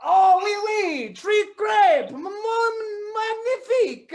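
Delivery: high, gushing mock French accent; excited French-accented exclaiming. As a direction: swoon over it in hammed-up French. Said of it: "like we are all from France".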